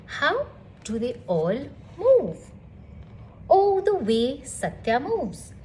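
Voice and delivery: plain reading voice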